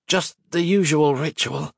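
strained, teeth clenched; through clenched teeth. A narrator's reading. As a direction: speak through gritted teeth. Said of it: "he said between clenched teeth".

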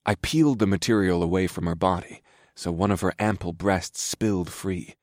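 ordinary delivery, nothing marked

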